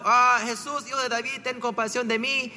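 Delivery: ordinary delivery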